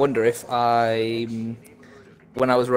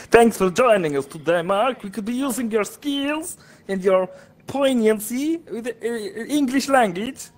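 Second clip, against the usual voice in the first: high-pitched Italian streamer voice; Italian accent; 9 semitones higher than usual. Acted. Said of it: "This time I'm, uh, Italian".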